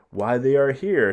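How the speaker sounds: loud, high gloating line; spring the horrible surprise; triumphant reveal. Acted. Titus's gleeful reveal.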